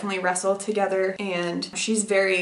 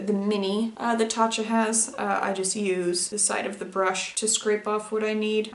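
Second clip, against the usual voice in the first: usual speaking voice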